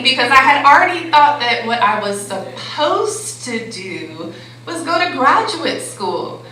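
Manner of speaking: ordinary delivery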